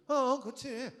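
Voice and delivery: sarcastic Jonah scoffing at God's love. Acted. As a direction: sneer out the praise of God's love. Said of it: "비꼬는 거예요".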